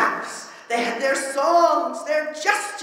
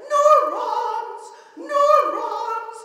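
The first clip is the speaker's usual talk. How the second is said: high, shrill scientist shriek; theatrical high-pitched scream; 11 semitones higher, 4 dB louder, much slower than usual. Acted. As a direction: scream it as a mad scientist in a poem. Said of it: "I would scream in my scientist voice".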